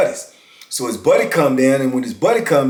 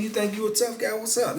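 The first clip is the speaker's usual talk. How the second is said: mocking guard voice, a bit higher; guard taunting; 7 semitones higher, 10 dB quieter than usual. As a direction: taunt him like a cocky guard. Guard taunting him, retold.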